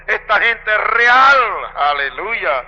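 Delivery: building from strong to a loud ecstatic cry; rising exultation. Real, not acted. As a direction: preach it with swelling joy. Preacher exults about heaven.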